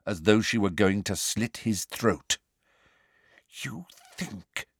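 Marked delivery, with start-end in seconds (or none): 3.6-4.7 soft menacing whisper. A narrator's reading. Umbridge whispers to Harry, menacing. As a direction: whisper the threat close. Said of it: "she whispered".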